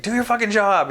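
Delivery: high, loud re-enacted demand; retold yelling. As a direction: yell it at hospital staff, as in the retold fight. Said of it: "I was yelling at people".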